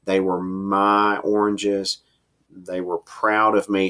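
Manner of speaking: slow, deliberate short sentences; staccato, slowed pace. Shown as a demonstration. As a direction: let each short sentence land slowly. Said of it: "three staccato short sentences so that you really slow the pace down".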